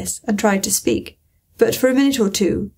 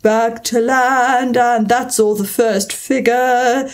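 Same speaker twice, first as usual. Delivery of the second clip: ordinary delivery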